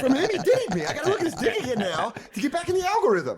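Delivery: high, laughing mock outrage; laughing disbelief. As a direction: squeal it out between laughs. Retold own reaction, laughing.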